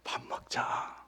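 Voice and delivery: whispered, tender "let's eat"; Jesus whispering an invitation to eat; soft invitation. Pretend. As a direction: hush it like a gentle invite. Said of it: "밥 먹자라는 말씀을 잘하세요".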